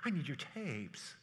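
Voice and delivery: mock anxious, drawn-out pleading; mild mock panic; freaked-out buyer. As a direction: play the scared follower begging for tapes. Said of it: "you're freaked out and like".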